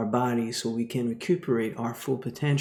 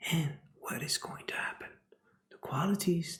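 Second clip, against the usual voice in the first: whisper; 3 semitones higher, 8 dB quieter, faster than usual. Real, not acted. Dramatic whispered question.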